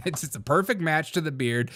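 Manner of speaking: usual speaking voice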